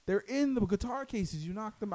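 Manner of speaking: high, incredulous comeback starting loud; mock-outraged, easing off in volume at the end; retold exasperated protest. Retelling own side of argument.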